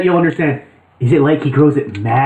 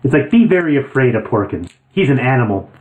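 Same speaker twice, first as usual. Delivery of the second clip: ordinary talk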